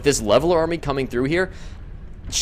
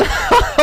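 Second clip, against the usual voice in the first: high crying laugh; shrieking laugh; 4 dB louder than usual. Real, not acted. Caster to viewers after the one percent save, shrieking laugh.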